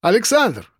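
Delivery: high falling hail; calling out. A narrator's reading. Coworker hailing Alexander in the canteen queue, calling out. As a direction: hail him by name across the room. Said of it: "окликнули меня".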